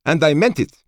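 high, loud commanding officer voice; crisp female officer, firm and high; female colonel voice. A narrator's reading. Colonel Schoen voiced by narrator.

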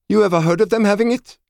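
high, loud commanding officer voice; crisp female officer, firm and high; female colonel voice. A narrator's reading. Colonel Schoen voiced by narrator.

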